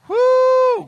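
high, loud preacher's woo; a shout of glory from the pulpit; exultant whoop. Real, not acted. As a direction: let out a joyful whoop.

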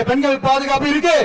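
ordinary talk

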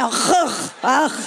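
throaty "achuch" as a disgusted noise; hammed-up throat-scraping gag; mock gagging sound. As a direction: imitate a woman retching in disgust. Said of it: "that's the sound the woman makes".

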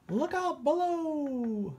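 high, slow squawky cry from above; warning cry. Acted. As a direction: shout a warning as it drops. Cry as cannonball falls.

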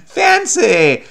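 high, loud, hammed-up FANCY; mock-fancy. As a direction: proclaim "Fancy" with laughing grandeur. Hams up the word fancy.

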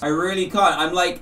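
ordinary talk, nothing marked

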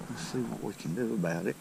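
soft, careful fishing talk; quietly intent on the catch; hushed. Real, not acted. Keeping still while stalking fish.

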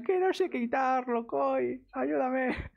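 high, slow whiny imitation of a half-crying friend; mock tearful plea. Streamer imitating his panicked friend on the phone, mock tearful high plea. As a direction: plead for help in a lightly hammed-up voice. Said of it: "medio llorando".

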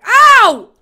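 sudden loud cry; startled yelp. Real, not acted. Player caught while getting away, yelping. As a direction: yelp as if hit.

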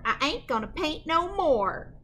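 ordinary delivery

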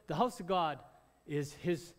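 ordinary talk, nothing marked

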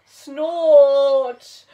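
big loud snort through the trunk; elephant snort. Acted. Elephant blowing water, loud snort. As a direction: blast out a snort. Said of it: "gave a great".

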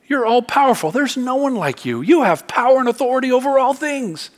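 high, swelling praise from the pulpit; fervent preaching. Acted. Preacher voicing Hannah praising God's power, fervent. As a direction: lift the pitch in awed, insistent praise.

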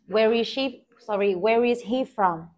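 usual speaking voice

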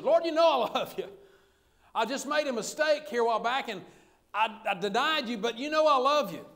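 acting out an aggravated disciple protesting his love. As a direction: insist on it, voice rising in frustration. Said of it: "Peter gets aggravated and says".

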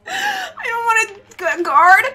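stammering, wailing reluctance; panicked whine. Real, not acted. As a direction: whimper it, then cry out. Panicking while being chased.

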